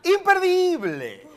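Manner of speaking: high, drawn-out, punchy plug; promotional hype. Real, not acted. Host plugging the play's dates, excited hype. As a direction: sell it big, stretching the last word.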